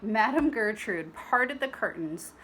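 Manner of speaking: ordinary delivery, nothing marked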